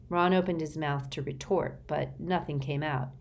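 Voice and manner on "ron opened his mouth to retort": plain reading voice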